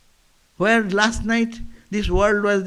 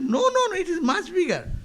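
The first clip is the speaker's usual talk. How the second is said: much higher, eager protest; insisting ocean frog; 10 semitones higher than usual. Acted. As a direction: cry out no, no, no as the big frog.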